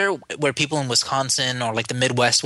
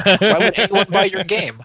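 mock skeptic; 5 semitones higher, 5 dB louder than usual. Mock question to rival devs.

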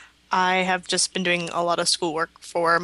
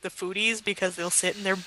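ordinary talk, nothing marked

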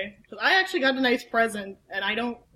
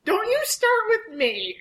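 mock scolding; 6 semitones higher than usual. Mock-scolding Mac after muzzle joke.

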